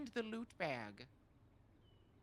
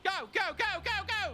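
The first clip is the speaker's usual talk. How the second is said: excited urging; 9 semitones higher, 8 dB louder than usual. Real, not acted. Urging partner to start driving.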